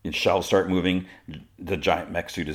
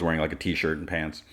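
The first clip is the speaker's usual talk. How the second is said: normal talking voice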